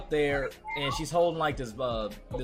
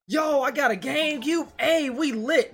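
much higher, hyped-up inner thought; imagine you just got the console you wanted; excited inner voice; 10 semitones higher than usual. Retold or imitated. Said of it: "and I'm like".

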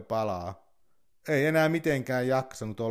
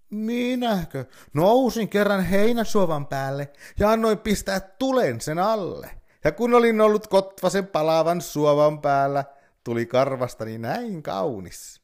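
high, bright-pitched fox voice, louder; sly boasting fox; 7 semitones higher, 7 dB louder than usual. Acted.

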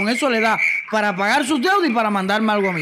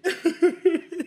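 gloating laugh; 5 semitones higher, 4 dB quieter than usual. Real, not acted. Gloating after the phone scene.

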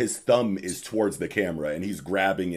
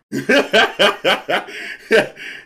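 burst of laughter; 13 semitones higher, 10 dB louder than usual. Real, not acted.

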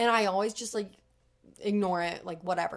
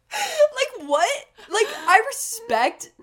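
high, loud disbelieving outburst; what-the-heck exclamation; incredulous; 13 semitones higher, 7 dB louder, much slower than usual. Real, not acted. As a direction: burst out in disbelief. Outraged at cauliflower throw.

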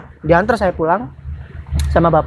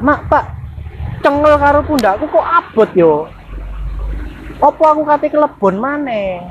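higher-pitched plaint to Mum and Dad; retold worried complaint; 6 semitones higher than usual. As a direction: retell a puzzled complaint in a raised pitch. Voices own complaint to parents. (menirukan keluhan cemas ke orang tua)

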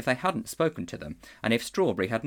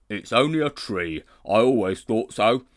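warthog; 7 dB louder than usual. A narrator's reading. Warthog declaring the fainted man a tree. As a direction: grunt it like a stolid warthog.

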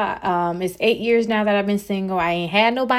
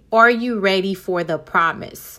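ordinary talk

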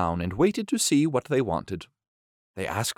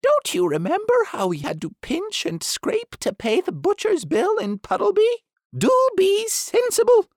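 high duck voice scolding the doctor; bossy duck; 6 dB louder than usual. Acted. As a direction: insist like a fussy duck.

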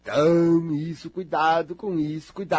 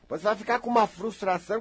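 normal talking voice

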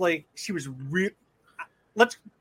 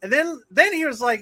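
ordinary talk, nothing marked

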